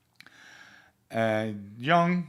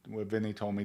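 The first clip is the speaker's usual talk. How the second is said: normal talking voice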